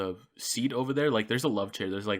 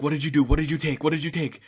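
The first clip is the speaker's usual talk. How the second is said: urgent demand; 5 semitones higher, 6 dB louder, faster than usual. Retold or imitated. Parents demanding retold.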